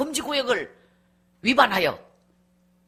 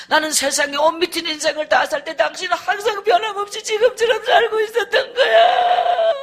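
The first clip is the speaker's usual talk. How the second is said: imitated crying speech; 3 semitones higher, 4 dB louder than usual. Husband honouring wife's prayers, tearful rising to wail. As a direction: pour it out until it breaks into bawling. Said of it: "울고 있는 소리가 계속 들리는데".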